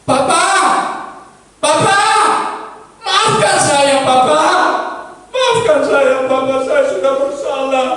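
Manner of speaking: put-on high, loud child begging forgiveness; pleading child. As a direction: beg your father, tearful child. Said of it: "dia minta ampun sama orang tua". (anak memohon ampun dengan suara tinggi)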